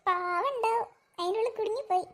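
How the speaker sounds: usual speaking voice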